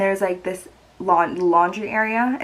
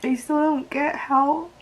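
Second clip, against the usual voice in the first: tearful, strained voice, a little higher; crying over the breakup; real crying; 5 semitones higher, 3 dB quieter than usual. Real, not acted. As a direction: push the words out through tears. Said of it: "why am I crying about this".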